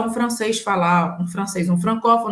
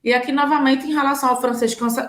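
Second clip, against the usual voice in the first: usual speaking voice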